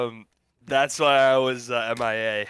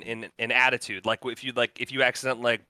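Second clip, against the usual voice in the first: usual speaking voice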